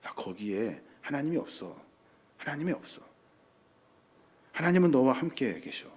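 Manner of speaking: hushed, intimate reassurance; God murmuring gently to a frightened prophet; still small voice. Acted. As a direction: speak very softly, pausing between phrases. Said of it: "라고 하시는 말씀을 세미하게 말씀하셔요".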